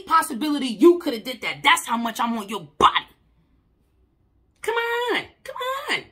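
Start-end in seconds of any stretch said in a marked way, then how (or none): 4.6-6.0 high, strained cry of come on; pushing through the hurt; pained cry out. Real, not acted. Speaking to herself, a high strained plea.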